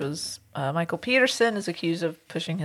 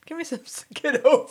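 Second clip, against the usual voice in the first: dog voice; 7 semitones higher than usual. Pretend. Voicing Charlie the dog.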